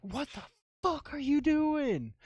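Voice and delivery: high, drawn-out outraged yell; imitating furious mom. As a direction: storm in as mom, voice shooting up. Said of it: "Mom stormed in and was like".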